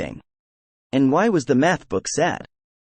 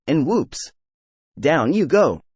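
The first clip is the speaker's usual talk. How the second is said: normal talking voice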